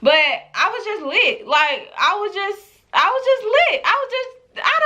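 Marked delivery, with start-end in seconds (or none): none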